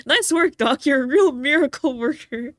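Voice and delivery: high, loud gleeful cheer; loud excited praise. Acted. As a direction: shout it, laughing with joy. Relieved praise with laughter.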